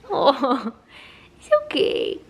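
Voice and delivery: high-pitched comforting coo. Real, not acted. Comforting baby, saying it's okay, cooing. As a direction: soothe a little one in a high voice.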